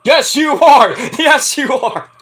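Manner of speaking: high, loud mock argument; insistent shouting. Wrestler argues that he is Elias, insistent mock shouting.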